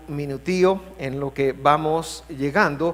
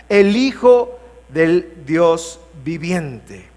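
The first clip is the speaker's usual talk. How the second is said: slow, weighty Bible reading with long pauses; proclaiming a confession of faith; solemn scripture declamation; 2 semitones higher, 4 dB louder, slower than usual. Acted. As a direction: declaim it boldly, phrase by phrase. Declaims Peter's answer from scripture.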